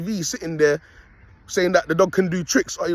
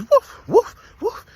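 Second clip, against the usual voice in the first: mock dog bark, the last woof much higher; imitated dog barking; 3 dB louder, much slower than usual. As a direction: yap it out like the small dog barking. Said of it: "He's barking at my dog".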